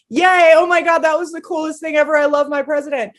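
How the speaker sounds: high, loud gleeful cheer; cheering yay. Retold or imitated. Cheering her president in the room, gleeful. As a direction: squeal like a fan. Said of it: "me going".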